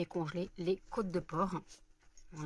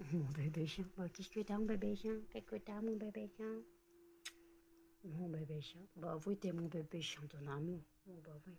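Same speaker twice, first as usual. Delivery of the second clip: doting dog talk; 9 dB quieter than usual. Real, not acted. Asking the baby dog what's wrong, tender cooing. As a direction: murmur lovingly to a dog.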